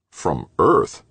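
can't believe what he hears; incredulous. A narrator's reading. McClintock doubting the Earth lab theory, incredulous. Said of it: "McClintock sounded totally incredulous".

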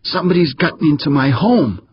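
high, frightened inner scream; panicked screech. A narrator's reading. A man screeching in panic at the intruders. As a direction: shriek it in terror. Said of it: "screeched a voice in his head".

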